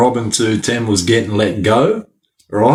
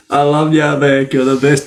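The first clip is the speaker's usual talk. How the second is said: ordinary talk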